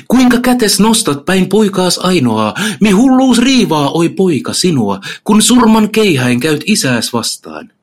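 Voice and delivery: high, loud pleading cry; shouted plea. Acted. Sin begs Satan not to strike their son, high pleading shout. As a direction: scream at them to stop the fight. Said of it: "ja huutaa".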